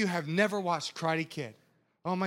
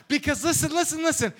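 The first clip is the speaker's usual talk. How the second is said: normal talking voice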